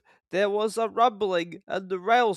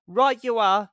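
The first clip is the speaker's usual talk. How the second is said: higher, louder agreement; eager Thomas; 6 semitones higher, 6 dB louder than usual. A narrator's reading. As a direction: agree brightly. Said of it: "said Thomas".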